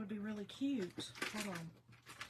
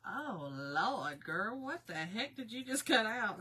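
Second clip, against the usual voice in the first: dismayed self talk; slower than usual. Retold or imitated. Crafter telling viewers how she scolded herself, mock horrified. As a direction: slowly scold yourself over a botched leaf. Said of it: "and I said".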